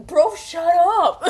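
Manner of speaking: high, loud, slow yell; laughing yell. Real, not acted. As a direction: tell him off loudly, half laughing. Yelling at character.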